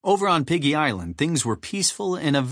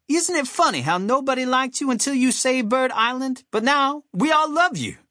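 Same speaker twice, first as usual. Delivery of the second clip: very high cartoon bird voice; squeaky, chirpy admirer; Alex; 13 semitones higher than usual. A narrator's reading. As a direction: gush in a high-pitched, calling voice. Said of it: "Alex shouted out to him".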